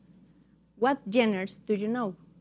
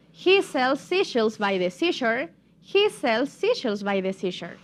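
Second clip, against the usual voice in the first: tongue twister joined up, slightly quicker; a bit faster. Shown as a demonstration. Teacher says it one more time, a bit faster. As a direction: run the phrases together without gaps. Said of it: "pero un poco más rápido".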